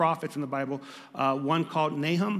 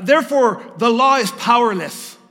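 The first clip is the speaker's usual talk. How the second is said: much higher, loud despairing declaration; prophet's loud lament; 7 semitones higher, 11 dB louder than usual. Acted. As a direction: proclaim the law's failure in despair. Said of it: "He says".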